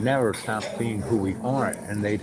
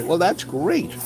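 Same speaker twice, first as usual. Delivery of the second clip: higher, mock-impressed delight; pleased reply; 7 semitones higher, slower than usual. Speaker replaying his answer to the woman, pleased. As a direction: act out a delighted reaction, pitch lifted.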